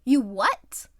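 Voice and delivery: shocked and loud, voice jumping up; horrified disbelief. Acted. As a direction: blurt it out in horror at what he just heard. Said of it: "eyes widened in horror".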